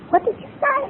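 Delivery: high, amazed question; stunned disbelief. Retold or imitated. Husband can't believe his wife apologised, high amazed. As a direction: act like you can't believe your ears.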